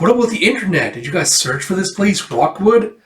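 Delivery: eager questioning. Acted. Lucy asks about searching the internet, eager. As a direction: press eagerly for an answer.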